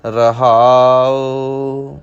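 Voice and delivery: slow, drawn-out scripture reading with long line endings; Gurbani recitation. Shown as a demonstration. Teacher models the verse for learners, slow measured recitation. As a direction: recite it slowly, holding the final vowels.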